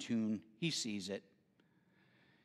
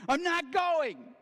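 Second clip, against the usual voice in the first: put-on high, loud whiny boy; pouting kid digging his heels in; sulky child; 14 semitones higher, 5 dB louder, slower than usual. Son refusing church, joke story.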